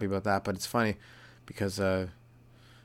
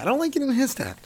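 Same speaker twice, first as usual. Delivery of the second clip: exasperated protest; 5 dB louder than usual. Acted. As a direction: voice shooting up high and loud. Acts out being fed up.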